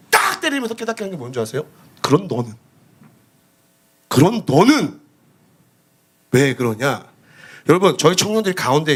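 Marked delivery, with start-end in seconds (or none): 2.0-7.0 low, slow rebuke from above; a hint of God's stern voice. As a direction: speak down to him as God would. Voicing God rebuking him.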